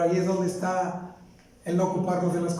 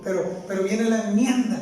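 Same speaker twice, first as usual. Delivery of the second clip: ordinary talk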